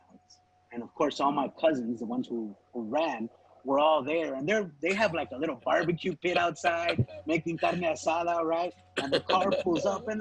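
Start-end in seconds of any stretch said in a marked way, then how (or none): none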